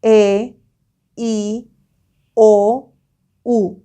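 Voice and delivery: each vowel said slowly and apart; slow school dictation. Shown as a demonstration. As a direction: spell out E, I, O, U slowly. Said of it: "the vowels are A".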